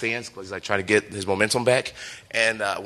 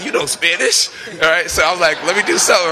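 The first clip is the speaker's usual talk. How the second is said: excited; 7 semitones higher, 7 dB louder than usual. Retold or imitated. Excited retold reply to partner.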